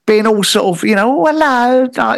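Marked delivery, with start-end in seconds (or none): 1.2-1.9 high-pitched monkey lady voice. Acted. Monkey lady in stilettos greets customer, high put-on voice. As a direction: put on a higher, flirty lady's greeting. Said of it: "there was one monkey lady".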